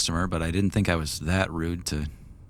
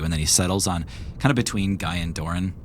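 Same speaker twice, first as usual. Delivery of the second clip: usual speaking voice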